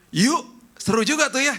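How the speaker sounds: high, loud eager agreement; bright eager yes to the movie plan. Retold or imitated. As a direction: jump at the invitation. Friend eagerly accepting movie invite.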